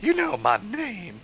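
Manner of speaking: put-on high girl voice. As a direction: say it like a smitten teen girl. Girl character voice.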